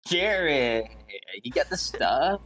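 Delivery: eager buyer. Retold or imitated. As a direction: call out like a buyer hungry for the goods. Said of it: "They'll be like".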